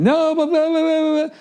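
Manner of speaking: high-pitched mocking blah-blah of her protests; mock whiny girlfriend. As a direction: parrot her pleading as a squeaky blah. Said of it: "mocking her protests".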